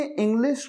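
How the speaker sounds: "इंग्लिश" with a plain s at the end; mispronounced English. Shown as a demonstration. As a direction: say "इंग्लिश" ending in s, not sh. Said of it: "सरिता वाला स कहा".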